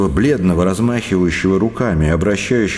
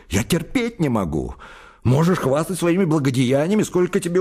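higher, agitated, voice climbing; higher, agitated rebuke; raised voice, agitated; 6 semitones higher, 3 dB quieter than usual. A narrator's reading. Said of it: "возвышая голос и от волнения".

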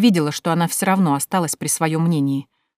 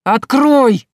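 high, loud, drawn-out yell of «Открой»; frantic escalated cry; strained shout; 8 semitones higher, 9 dB louder than usual. Acted. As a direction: holler it desperately through a locked door. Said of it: "надрывно выкрикнул Тимофей Яковлевич".

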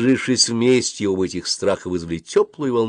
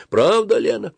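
high-pitched call to another room; 13 semitones higher, 3 dB louder than usual. A narrator's reading. Dentist to her helper next door, calling. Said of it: "крикнула она в другую комнату".

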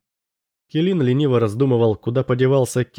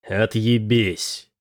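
very slow, deliberate dismissal; much slower than usual. A narrator's reading. Kelin out of patience with the quartermaster, slow dismissal. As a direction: stretch the curse out without raising your voice. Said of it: "медленно сказал Келин".

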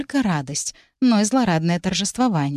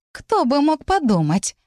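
slow, bitter sarcastic question; bitter sarcasm; 8 semitones higher, slower than usual. A narrator's reading. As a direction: sneer it slowly and bitterly. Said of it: "sarcastic repeat of line 17".

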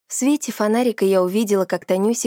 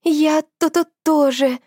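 stammering fear; 6 semitones higher than usual. Acted. Narrator stammers in fear too.

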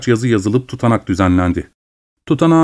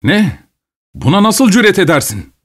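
high indignant gasp of outrage; can't believe the nerve; outraged disbelief; 8 semitones higher than usual. Acted. Overseer outraged at the peasant's cheek, high disbelief.

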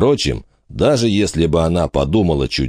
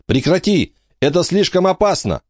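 urgent high cry to stop him; alarmed warning; 13 semitones higher than usual. A narrator's reading. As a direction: pull him back in alarm. Kate holding back frantic boy.

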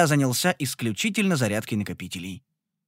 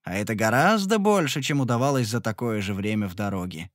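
ordinary delivery, nothing marked

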